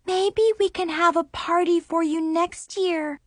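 ordinary delivery

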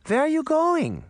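very high-pitched calling question; alarmed grandmother. A narrator's reading. Granny calls after Puck as he leaves, high alarmed question. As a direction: call out after him in a high old woman's voice.

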